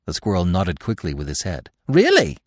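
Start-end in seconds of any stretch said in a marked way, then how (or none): 1.9-2.4 high, loud squeak of surprise; surprised. A narrator's reading. Squirrel to Bambi, high surprised squeak. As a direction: jump at the news. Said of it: "he said, surprised".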